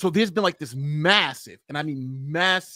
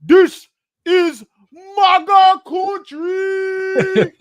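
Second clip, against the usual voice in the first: Nigerian accent, building to a slow high shout on maga country; hammed-up Nigerian accent, bellowing the last words; 11 dB louder, much slower than usual. Shown as a demonstration. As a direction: Nigerian accent yelled as a joke threat. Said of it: "I gotta do it in my Nigerian accent".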